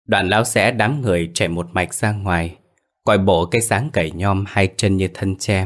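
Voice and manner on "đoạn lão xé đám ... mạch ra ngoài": usual speaking voice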